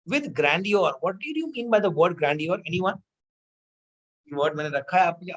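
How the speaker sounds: ordinary delivery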